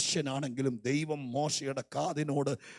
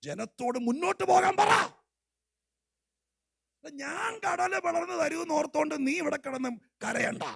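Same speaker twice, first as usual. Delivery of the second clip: commanding shout; 13 dB louder than usual. Acted. God's commanding rebuke to Moses.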